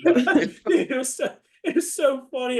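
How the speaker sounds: high, laughter-filled voice; cracking up. Real, not acted. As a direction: squeal it out through real laughter. Laughing fit over the story.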